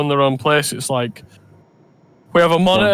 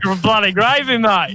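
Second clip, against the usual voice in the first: light mock Australian accent; tossed-off Aussie twang, just for fun; a hint of Australian accent joking about gravy on pancakes; 4 semitones higher than usual. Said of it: "I'm doing an Australian accent for that".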